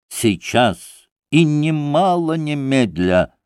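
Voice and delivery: slow, stern, nearly angry insistence on «сейчас и немало немедля»; doctor insisting sternly on «сейчас и немало немедля»; almost angry. A narrator's reading. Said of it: "не то что строго, а почти гневно".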